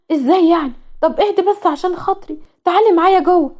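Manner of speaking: lightly voiced two-faced cousin. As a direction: coax her inside, pitched high. Hiyam feigns concern.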